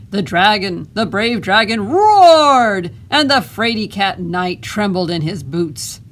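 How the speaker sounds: loud dramatic read-aloud that peaks on roared; booming voice. Acted. Boy reading the brave dragon story to the crowd, booming and dramatic. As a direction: swell up big on roared, then settle. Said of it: "in a booming voice".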